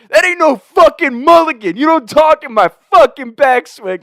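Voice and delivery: very high, loud outraged yell; imitated furious shout. Golfer refusing his friends' offered redo, furious shout. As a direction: bellow it back at the top of the range.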